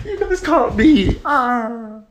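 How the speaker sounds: high, slow, hammed-up surrender cry; teacher pretending to lose to her pupils; mock defeat. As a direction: play dead for the kids, high and drawn out. Teacher fakes being caught by kids.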